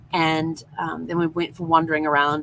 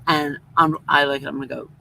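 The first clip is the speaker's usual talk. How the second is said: ordinary delivery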